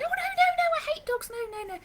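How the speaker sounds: very high, loud squealing no-nos, fading; squealing dog-hater. Retold or imitated. Person squealing at a jumping dog, very high and loud. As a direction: shriek it like you hate dogs. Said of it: "We might be going".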